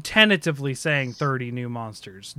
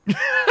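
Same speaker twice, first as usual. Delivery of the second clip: short high giggle; quick squeak of laughter; 6 dB louder than usual. Real, not acted. Laughs at own joke.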